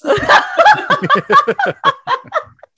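long high witch cackle. Pretend. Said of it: "that witch voice we were practicing earlier".

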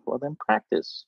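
understated deadpan reply; dry quiet retort. Retold or imitated. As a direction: shrug off the question quietly. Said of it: "It's like".

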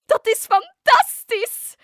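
very high, loud, drawn-out cry of joy; delighted shriek. Acted. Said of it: "gilde Fiona". (gillen van blijdschap)

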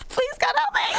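very high comic cry for help; mock panicked plea. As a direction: squeal for help in fake terror. Joins the Bigfoot skit as victim.

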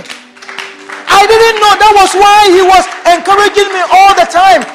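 fervent preacher's cry; preaching at full force. Acted.

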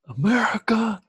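high, crying "America"; mock weep with patriotic pride; echoing a friend with hammed-up patriotic tears; mock patriotic sob.

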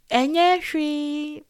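slow, drawn-out soothing dismissal; Twi brush-off. Retold or imitated. Church elder telling you it's nothing, slow soothing dismissal. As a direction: stretch it out like an elder calming you. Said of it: "common thing I used to hear".

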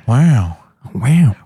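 single drawn "wow" with rising-falling swoop; Owen Wilson impression. Co-hosts trading wows, celebrity impression. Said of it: "Owen Wilson impression".

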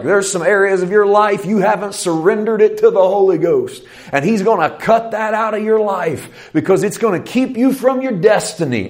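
high-pitched, insistent preacher delivery; re-enacted preaching. Preacher warning the congregation about unsurrendered lives, re-enacted preaching. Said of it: "I was big about that. I'd be like".

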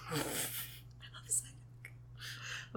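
very soft, drawn-out whisper; whispered affection. Real, not acted. Fan confessing love for Psyduck, whispered. Own fond aside against everyone else.

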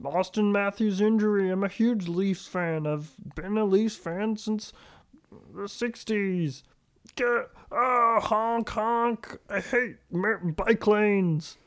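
high, slow Leafs fan voice; dopey suburban Toronto guy; Toronto dialect. Retold or imitated. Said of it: "Your regional dialects have gotten very on point".

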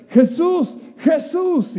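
high calling out to Jesus. Retold or imitated. Blind beggar calls out to Jesus. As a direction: call his name from afar. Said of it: "él empieza a gritar".

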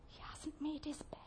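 unvoiced, seething thought to oneself; whispered grumble. Preacher acts a whinger walking through the front door, whispered grumble. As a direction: whisper it as a private complaint.

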